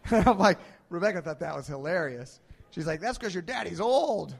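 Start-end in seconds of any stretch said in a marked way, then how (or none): 3.0-4.3 teasing wife. Retold or imitated. Wife teases in a story.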